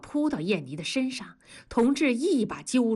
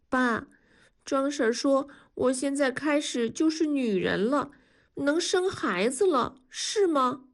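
put-on higher girl's voice, uneasy and puzzled; a frightened daughter asking her father; young girl voice; 5 semitones higher than usual. Narrator voices daughter Yanni. (提高音调的小女孩声音，带点惊慌)

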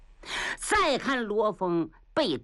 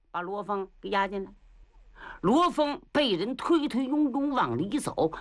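ordinary talk, nothing marked

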